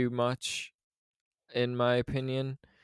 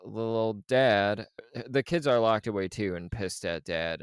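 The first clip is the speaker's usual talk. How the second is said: normal talking voice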